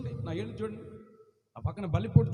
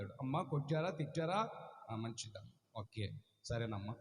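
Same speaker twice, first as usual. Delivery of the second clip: meek mock reply; 11 semitones lower, 8 dB quieter than usual.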